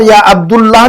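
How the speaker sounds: अब्दुल्लाह said "abdullah", with u after d; wrong u vowel. Shown as a demonstration. Teacher showing students the reading to avoid, u-vowel form. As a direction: put a short u in the middle of अब्दुल्लाह. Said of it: "नहीं पढ़ेंगे अब हम".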